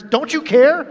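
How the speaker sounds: acted parental irritation, voice lifted; irritated. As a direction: throw it out as an accusation. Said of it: "It was with a bit of an irritation".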